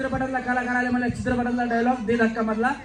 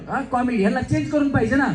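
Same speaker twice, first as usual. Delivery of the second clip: normal talking voice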